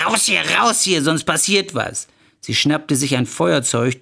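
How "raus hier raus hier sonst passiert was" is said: high, shrill acted outburst. Wife throwing rival out, voice tipping over shrill. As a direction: let the voice tip up into a screech. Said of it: "Ihre Stimme kippte, wurde schrill".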